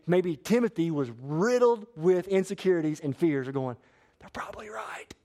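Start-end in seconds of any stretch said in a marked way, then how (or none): 4.2-5.1 whispered self-doubt, barely voiced; insecure whisper. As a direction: whisper it like a private fear. Timothy's imagined insecure thought.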